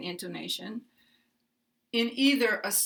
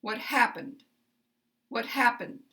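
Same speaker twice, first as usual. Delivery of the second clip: first syllable of "happened" up, second stepped down; step-down question intonation; 2 semitones higher than usual. Shown as a demonstration. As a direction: stress both words of "What happened", dropping at the end. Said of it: "then step down again".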